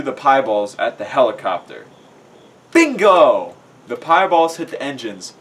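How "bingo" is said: loud, high exclamation; triumphant. A narrator's reading. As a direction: shout it in triumph. Narrator exclaims at a hit.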